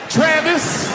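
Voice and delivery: high exasperated call of the name. Acted. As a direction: call Travis out, fed up and high. Calling out the bodyguard's name.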